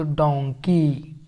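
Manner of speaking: डोंकी said slowly with a clear open o, like "dong-kee"; डोंकी with o sound. Shown as a demonstration. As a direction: stretch out डोंकी with the o of "on". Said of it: "इस तरह से डोंकी हम लोग पढ़ दिए". (डोंकी को ओ की आवाज़ के साथ धीरे से बोलें)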